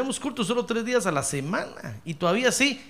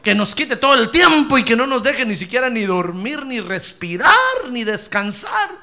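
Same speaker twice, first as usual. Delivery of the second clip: louder, higher joyful preaching; 4 semitones higher, 9 dB louder than usual. Real, not acted. Pastor rouses congregation about God's goodness, loud and joyful. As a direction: preach it out with a laugh in the voice.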